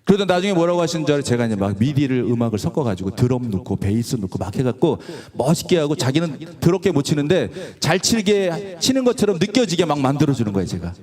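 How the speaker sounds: ordinary delivery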